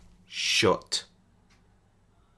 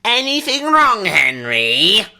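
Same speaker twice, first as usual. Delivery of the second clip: high, lilting, falsely sweet question; sugary needling, pitched high then dropping; mock sweet; 9 semitones higher, 3 dB louder than usual. A narrator's reading. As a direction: ask it oh so sweetly. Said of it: "said Margaret sweetly".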